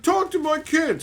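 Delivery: high mocking parent voice; goofy dad. Dad pressing streamer to greet his kid, mock goofy. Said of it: "people get goofy over their kids".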